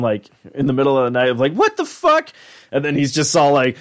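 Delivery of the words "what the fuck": very high startled squeak; Morty. Retold or imitated. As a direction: squeal it as if jolted awake. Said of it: "Morty just literally woke up".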